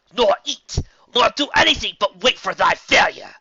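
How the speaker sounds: loud, high, building divine menace; menacing threat. Acted. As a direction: crush him with godlike threat. Celestia threatens the guard.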